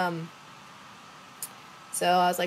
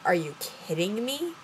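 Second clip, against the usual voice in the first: drawn-out incredulous question, rising at the end; retold disbelief. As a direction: react as if you cannot believe it. Her reaction to cancelled date.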